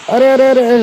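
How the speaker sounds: sudden high alarmed cries; alarmed shout. Real, not acted. Gamer yelling at an attacking player, alarmed. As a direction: yell out as an enemy rushes in.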